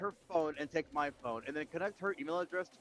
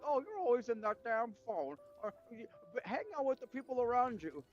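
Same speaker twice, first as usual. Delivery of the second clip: higher, nagging elder impression; nagging old person voice; 6 semitones higher than usual. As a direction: scold like a grumpy grandparent. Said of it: "old people are just like".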